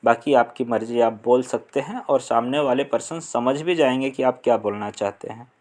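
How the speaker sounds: normal talking voice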